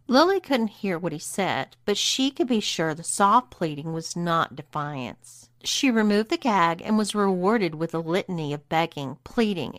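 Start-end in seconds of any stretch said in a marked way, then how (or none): none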